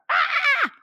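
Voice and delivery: piercing high squealing scream like a piglet. A narrator's reading. Girl pulled from mailbox, high piglet-like scream. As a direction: let out a shrill pig-like shriek. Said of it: "schreeuwde als een speenvarken".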